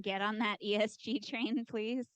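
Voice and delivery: rising to a loud, urgent appeal; loud plea. Real, not acted. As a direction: push the crowd to say yes. Rallying the room to act.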